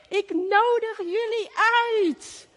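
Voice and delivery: high called-out invitation; high-pitched herald's cry. Retold or imitated. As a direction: call it out like a town herald. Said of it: "Ze schreeuwen het uit".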